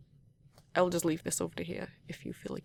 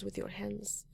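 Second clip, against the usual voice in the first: ordinary delivery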